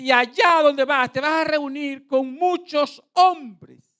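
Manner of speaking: slow, high, booming prophecy; prophet's proclamation. Retold or imitated. Preacher imitates the man foretelling a great meeting, slow loud proclamation. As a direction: stretch the words out in a loud declaration. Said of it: "Oiga lo que dijo".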